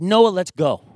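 high, loud fatherly command; exasperated dad ordering his son along. Retold or imitated. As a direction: round up a stubborn kid, loudly. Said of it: "start getting the dad voice".

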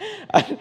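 a quick high embarrassed laugh. Real, not acted. Pastor laughing at his own shirt story, high bursting laugh. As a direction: laugh at yourself, short and high.

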